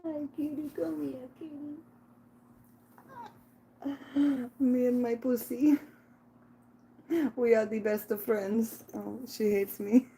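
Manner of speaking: high cooing pet voice. Real, not acted. Baby talk to her cat.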